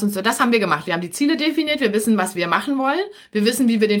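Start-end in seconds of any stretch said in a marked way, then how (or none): none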